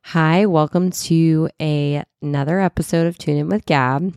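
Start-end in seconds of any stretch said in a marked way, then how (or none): none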